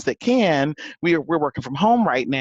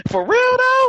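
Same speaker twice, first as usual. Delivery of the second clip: high, louder DC Jersey "for real, though?"; DC Jersey accent; 4 dB louder than usual. Retold or imitated. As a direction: throw it out in a country DC Jersey accent. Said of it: "I popped up with my country D C Jersey accent".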